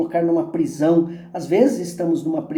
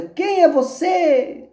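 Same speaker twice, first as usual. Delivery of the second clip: high, stretched-out echo; slow, high calling voice; 12 semitones higher, much slower than usual. A narrator's reading. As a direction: answer back like a mountain echo. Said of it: "Recebe como resposta".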